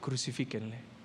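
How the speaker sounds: usual speaking voice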